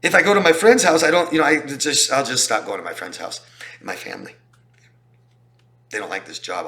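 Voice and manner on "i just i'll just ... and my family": ordinary talk, nothing marked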